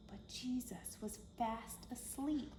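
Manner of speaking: hushed storyteller whisper; soft whisper under the storm. A narrator's reading. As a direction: drop to a whisper so he won't wake. Storm hushes for sleeping Jesus.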